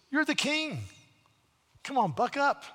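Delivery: high, sharp voice of a scornful wife; mock him into action in a high voice; wife telling the sulking king to buck up, high and scornful; chiding queen. Jezebel voiced chiding Ahab.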